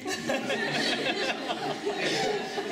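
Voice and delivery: long, loud, high-pitched laughter; real squealing-high laugh. Real, not acted. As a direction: burst into a lengthy laughing fit. Laughing at retold exchange.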